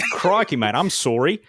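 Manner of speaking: mock a friend's shaky Australian accent; an exaggerated Australian accent that slips toward Canadian. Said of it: "Australian by the way of Canada".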